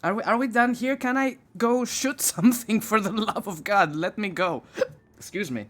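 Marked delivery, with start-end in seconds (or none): 4.8-5.7 hiccuping mid-sentence; talking through hiccups. Real, not acted. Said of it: "I got the hiccups".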